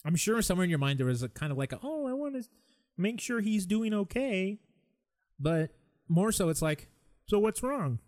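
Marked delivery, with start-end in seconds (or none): 1.8-4.6 high, drawn-out cooing imitation; mock a caring friend, high and slow; well-meaning concern. 7.3-8.0 high-pitched mock inquiry; prying questioner. Imitating prying person asking.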